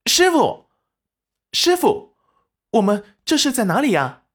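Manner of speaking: put-on high, excited girlish call; joyfully calling out to master; delighted surprise. A narrator's reading. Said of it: "立即惊喜地叫道".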